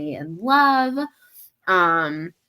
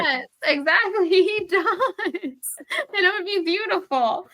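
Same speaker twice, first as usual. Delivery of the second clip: giggling, much higher, dreamy; laughing gush; 13 semitones higher than usual. Real, not acted. Host gushing about Matt the prosciutto guy, giggly. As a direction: swoon through a laugh.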